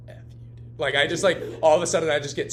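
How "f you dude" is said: breathy unvoiced mutter of F you, dude; whispered insult. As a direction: whisper it under your breath. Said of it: "I'm just like".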